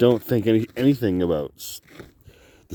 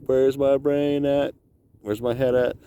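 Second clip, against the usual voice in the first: mock yell into a cave; 7 semitones higher than usual. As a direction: shout it down a tunnel. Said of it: "you yell".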